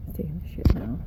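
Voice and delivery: hushed, breathy aside; whispered aside. Real, not acted. Owner whispers to the camera while the goat poops. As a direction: whisper it so only the camera hears.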